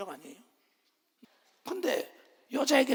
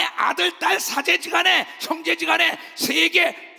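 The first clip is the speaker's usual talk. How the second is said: loud rousing sermon build; fiery pulpit preaching; full-force preaching; 6 semitones higher, 4 dB louder than usual. Acted. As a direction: stir up the congregation.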